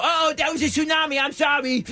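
high hammed-up goofy voice; mock exaggerated voice. [name] mocking waitress again.

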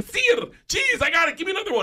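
very high, squealing eagerness; giddy, high-pitched showing off; excited. Acted. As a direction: shout answers like a thrilled quiz contestant. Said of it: "behind the bar excited".

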